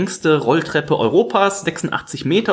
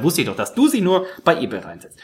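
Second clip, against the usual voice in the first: ordinary talk, nothing marked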